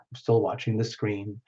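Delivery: "I'm still watching the screen" dropped low and soft. Real, not acted. Host murmuring to herself while watching chat, softly. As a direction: say it again under your breath to yourself. Repeated as a muttered aside.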